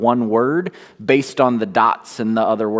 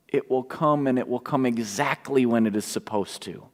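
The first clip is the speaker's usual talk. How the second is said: quiet; 8 dB quieter than usual. Acted. Preacher repeats promise softly.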